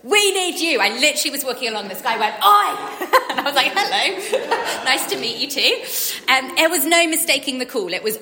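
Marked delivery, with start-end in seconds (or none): 0.0-0.8 loud, high yell across a crowd; urgent, hollered summons; yelled call. Retold or imitated. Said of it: "and he yelled, like". 2.4-3.1 loud high-pitched holler; sudden imitated shout; urgent shout. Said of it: "urgency with which he shouted". 3.8-5.8 high, laughing, taken-aback hello; amused, high-pitched mock politeness; startled polite greeting. Startled reply to shouting stranger, laughing.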